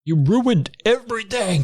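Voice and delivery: high, slow accusing cry; hammed-up high accusation; shrill villain. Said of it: "villain voiced".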